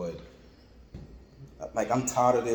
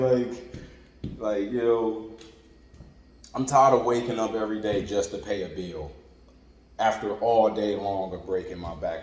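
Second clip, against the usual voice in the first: normal talking voice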